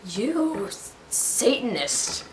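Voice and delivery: slow, drawn-out mock accusation. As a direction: drag the word out, teasing.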